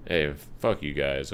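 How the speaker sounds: ordinary delivery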